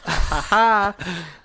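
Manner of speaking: loud burst of laughter; real laugh. Real, not acted. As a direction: laugh out loud at your own remark. Joking about his boss Mustang.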